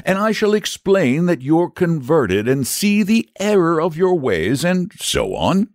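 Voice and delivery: high-pitched knight laying out the plan; explaining knight. Acted. Saint George explains the victory parade, matter-of-factly.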